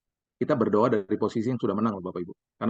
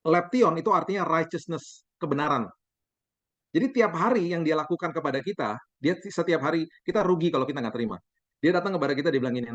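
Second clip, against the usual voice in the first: ordinary delivery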